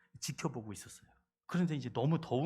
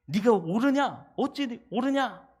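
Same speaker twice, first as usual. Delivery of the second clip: loud, slow, high challenge; God challenging; 9 semitones higher, 9 dB louder, slower than usual. Acted. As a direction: press the question slowly and hard. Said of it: "이제 그러는 거예요". (요나에게 묻는 하나님, 느리고 크게)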